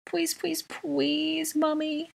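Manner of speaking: very high, sustained begging; babyish, drawn-out high whine; pleading child. A narrator's reading. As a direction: plead like a small child, lisping "please". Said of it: "he pleaded".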